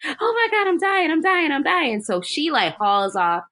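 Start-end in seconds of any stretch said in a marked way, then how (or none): 0.1-2.0 high mock panic, easing down; Carrie. Carrie pleading to the gym teacher, high mock panic. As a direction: imitate a frantic repeated plea, starting high. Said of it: "and Carrie's like".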